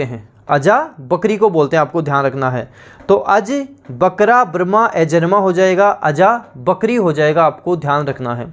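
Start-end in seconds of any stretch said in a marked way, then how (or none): none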